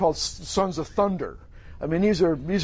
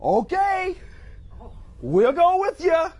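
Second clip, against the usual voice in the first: high, drawn-out singsong agreement; high-pitched, compliant group reply; eager followers; 11 semitones higher, slower than usual. Acted. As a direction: chime along like eager followers. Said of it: "What do the disciples say?".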